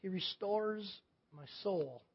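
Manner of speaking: very soft, lingering Scripture reading; slow, quiet reading. Shown as a demonstration. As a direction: draw it out in a hush. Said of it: "you're slowing quietly through it".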